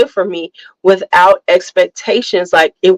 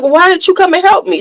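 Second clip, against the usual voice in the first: frustrated demand; 11 semitones higher, much faster than usual. Retold or imitated. Frustrated daughter confronting mother.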